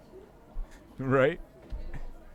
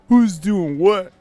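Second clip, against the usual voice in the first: slow, drawn-out segment title; show bit announcer; 5 semitones higher, 5 dB louder, slower than usual. Acted. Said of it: "I go out there and I say".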